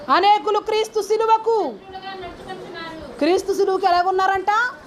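high, ringing scripture call-out; preaching. Acted. Preacher quoting scripture to the congregation, high-pitched preaching.

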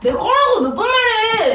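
high, whiny protest; exasperated whine. Acted. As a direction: whine it like a wronged kid. Kid insists the tablet is his.

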